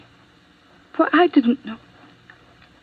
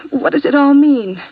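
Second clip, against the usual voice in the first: higher and louder, rattled and confused; agitated outburst; 6 semitones higher, 6 dB louder than usual. Acted. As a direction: push it up, flustered and loud.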